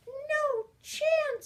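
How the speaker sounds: cheeky fairy. Acted. The fairy refuses the giant, high and sassy. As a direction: refuse like a naughty little fairy.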